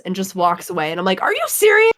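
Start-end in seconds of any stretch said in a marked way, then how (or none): 1.2-1.9 high, loud disbelieving squeal; incredulous outburst. Retold or imitated. Shocked disbelief at Vader's reply, squealed high. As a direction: she can't believe what Darth Vader just said.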